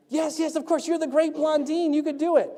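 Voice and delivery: high, eager chorus of belief; mock cheering crowd. Crowd urging on the tightrope walker, excited cheering. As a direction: gush like adoring fans. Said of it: "the crowd starts screaming".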